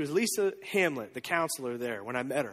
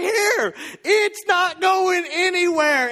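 high, loud, slow, triumphant call; joyful shout; 10 semitones higher, 7 dB louder, much slower than usual. Retold or imitated. Disciples calling to the crowd that the food will not run out, joyful shout.

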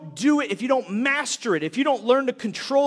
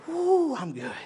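high whoo dropping into a casual sign-off; mock winded relief; 6 semitones higher, slower than usual. Lazy gym-goer quitting early, mock winded. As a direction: play the casual gym-goer who is already done. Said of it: "and you'll go".

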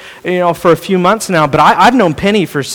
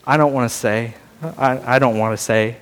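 low, soft, sheepish mock reluctance; playful imitation of someone shy about their age; embarrassed about age; 8 semitones lower, 7 dB quieter than usual. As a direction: mumble it like you're hiding your age. Older person dodging age question.

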